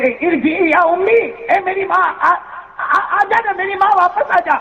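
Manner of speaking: high, keening cry to a dead mother; wailing lament. Retold or imitated. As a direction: wail it out like a son at the funeral. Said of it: "روتے روتے کیا کہتے معلوم". (بیٹے کا بلند آواز میں روتے ہوئے ماں کو پکارنا)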